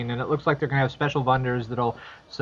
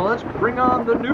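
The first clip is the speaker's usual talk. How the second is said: excited announcer; 9 semitones higher, 4 dB louder than usual. Acted. Host announces news segment.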